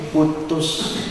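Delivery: a curt, breathy whisper of one word; flat whispered verdict. Retold or imitated. Mentor to junior: curt whispered verdict. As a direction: drop it like a final ruling. Repeated blunt advice.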